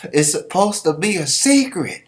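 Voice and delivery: high, loud exasperated hush-up; mocking crowd. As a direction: scold him for blurting the secret. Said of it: "Everybody looking at him like".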